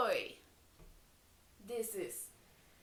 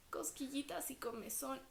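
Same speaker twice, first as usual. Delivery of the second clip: hushed quick whisper; 10 dB quieter, faster than usual. Acted. Teacher whispers to the kids about tickles. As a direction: hush it like a secret tickle. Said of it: "whispers the tickling part".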